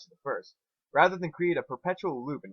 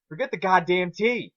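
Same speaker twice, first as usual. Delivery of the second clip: loud, high, exasperated outburst; angry snap; 7 semitones higher, 7 dB louder than usual. Acted. Twilight snaps at Spike over his tea, angry outburst. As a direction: bite his head off about the tea.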